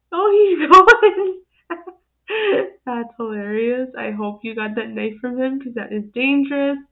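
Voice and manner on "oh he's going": high, loud and slow through laughter; loud giggling alarm; laughing alarm. Real, not acted. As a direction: laugh while panicking. Puppy runs with knife.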